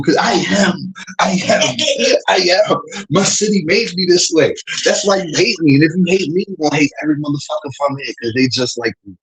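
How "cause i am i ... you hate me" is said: usual speaking voice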